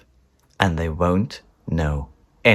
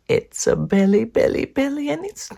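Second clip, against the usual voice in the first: much higher, delighted sing-song; gleeful. Acted. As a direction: coo over the cake. Gleeful eating.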